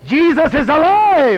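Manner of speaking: loud, high, drawn-out shout; triumphant proclamation. Real, not acted. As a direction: proclaim it at full force. Preacher proclaims to congregation.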